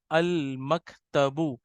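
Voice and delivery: अल कलमु said very slowly and clearly; teacher slowly modelling अल कलमु; slow demonstration. Shown as a demonstration. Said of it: "मकतबुन क्या बन जाएगा".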